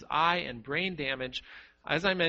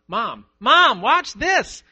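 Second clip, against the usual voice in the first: put-on loud, high-pitched child's call for attention; little boy shouting; 8 semitones higher, 13 dB louder, much slower than usual. Little boy calling to his busy mother, shouting. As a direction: shout it out high, like a boy wanting Mom to look. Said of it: "Your little boy keeps shouting".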